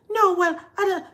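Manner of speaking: high, loud, flustered protest; mock an angry woman sputtering back; imitated anger. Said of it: "she was so angry".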